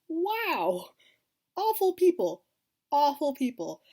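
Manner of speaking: loud appalled exclamation; disgusted outcry. Real, not acted. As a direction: spit out the disgust at awful people. Outraged at villains on show.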